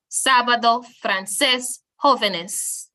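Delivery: SÁ in Sábado, CÉS in francés, JÓ in jóvenes stressed; stress on accented vowel. Shown as a demonstration. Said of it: "stress the syllable".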